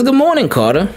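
high, bright teacher greeting; cheery teacher. Retold or imitated. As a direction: greet the child like a sunny teacher. Teacher greets son on camera.

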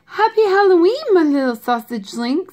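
lightly voiced mother, high happy greeting; doting mother. A narrator's reading. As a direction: greet your little one with a high lift that settles. Mother character greets her son.

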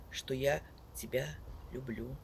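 ordinary delivery

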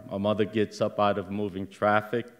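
usual speaking voice

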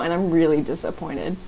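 ordinary talk, nothing marked

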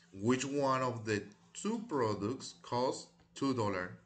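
slow, deliberate model sentence; slow read-aloud. Shown as a demonstration. Teacher modelling the full question, slow and deliberate. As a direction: spell out each word so the class can copy it. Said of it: "Y por aquí tienen two".

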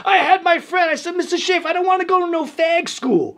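high-pitched, loud imitation of his younger self; protesting kid. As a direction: protest like a defiant Brooklyn kid.

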